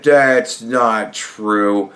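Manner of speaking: very slow, drawn-out mock denial. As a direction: stretch it out, mocking. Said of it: "Joe Biden starts saying".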